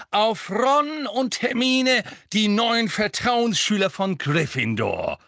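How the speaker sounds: loud, high, slow toast; festive toast. Acted. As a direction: raise the glass proudly. Toast raised.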